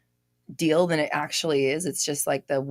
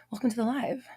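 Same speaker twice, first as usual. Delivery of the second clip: whispered welcome; 5 semitones higher, 4 dB quieter, faster than usual. Real, not acted. Greeting a newcomer softly.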